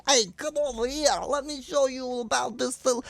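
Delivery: high, carnival hawker calling people over; fair barker. Retold or imitated. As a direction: pitch it high like a plate-smashing fair barker. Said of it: "those guys that are smashing the plates".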